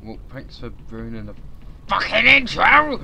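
rising to an angry yell on the last words; exasperated shout at the game; annoyed outburst. Real, not acted. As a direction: snap at the game for ruining it. Streamer yells at game interrupting.

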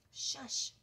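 sharp unvoiced shush; hissed warning to be quiet; whispered shush. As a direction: silence them in a whisper. Jared hushing during the creep.

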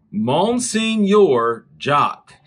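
Monsignor drawn out loudly as "mon-seen-yor", then Jock; repeated misreading. Retold or imitated. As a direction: slowly sound out Monsignor Jock, high and loud. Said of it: "And I said".

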